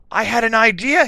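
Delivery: high, loud burst of excitement; exclaiming. A narrator's reading. Vampire after his brainstorm, high excited exclamation. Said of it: "he had exclaimed".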